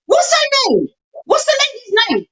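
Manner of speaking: high, squeaky upset questions; retold tearful alarm. Asking the caller for the victim's name, tearful alarm. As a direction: imitate yourself pressing a caller while distressed. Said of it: "I even started crying".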